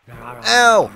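loud, high ow; sharp startled ow; yelp of pain. Real, not acted. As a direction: yelp as if suddenly struck. Hit in game.

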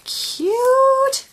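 very high, loud squeal of delight; real high-pitched delight at something adorable. Real, not acted. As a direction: gush in a loud, high coo. Delighted over a gift.

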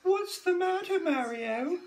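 high lilting princess voice. Shown as a demonstration. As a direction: redo it in the princess voice. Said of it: "I didn't do the voice".

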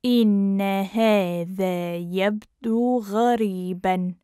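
measured, careful teaching pace. Shown as a demonstration. Teacher repeats phrase slowly joined.

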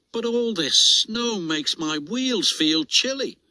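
put-on high, whining young engine voice; whiny little engine. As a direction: complain like a chilly little engine. Oliver whines about cold.

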